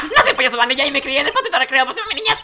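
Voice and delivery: high, fast comic clown voice; joking clown witness. Acted. Clown dodges the question in court, high and fast. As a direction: rattle it off like a manic clown.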